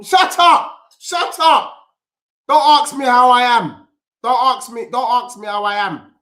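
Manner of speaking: loud, high outraged shout at a chatty pundit; shouting shut up. Acted. Fan yelling at a fake-friendly panel pundit, loud outraged shout. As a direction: bellow it, fed up with fake friendliness.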